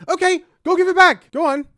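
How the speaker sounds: loud scornful demand to give it back; exasperated shout. Real, not acted. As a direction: shout it, fed up. Peak of angry rant.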